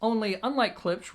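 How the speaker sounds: usual speaking voice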